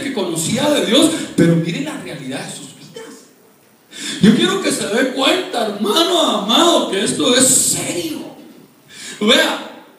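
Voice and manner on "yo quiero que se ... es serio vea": raised, urgent pulpit voice; forceful preaching. Real, not acted. Preacher warning the congregation this is serious, forceful preaching. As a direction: preach it loud and high.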